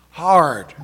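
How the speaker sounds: hard voiced groan falling away. A narrator's reading. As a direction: groan the word hard out loud. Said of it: "groaned aloud".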